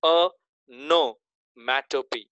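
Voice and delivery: "onomatopoeia" said slowly, starting with a clear "a" sound; correct pronunciation. Shown as a demonstration. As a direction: draw out "onomatopoeia" syllable by syllable. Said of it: "अ, सिर्फ अ".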